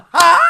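loud, high shocked "ha"; sharp cry of surprise; startled gasp. Acted. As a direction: gasp as the truth hits. Sees his real parents.

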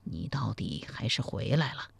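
low, soft, indifferent remark; father coolly greeting his returning son, low and soft; cool and detached. A narrator's reading. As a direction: say it flatly, holding back feeling. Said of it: "淡淡地说了一句".

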